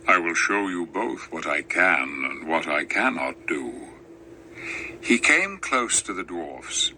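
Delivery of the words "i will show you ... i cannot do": deep lion voice. Acted. Aslan answers Lucy gently, low and grave. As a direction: speak like a wise great lion. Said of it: "said Aslan".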